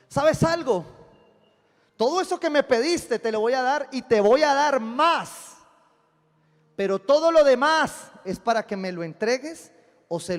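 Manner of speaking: high, loud preacher's call; preaching at full force. Acted. Pastor preaching to his congregation, loud and high. Pastor voicing God's promise loudly.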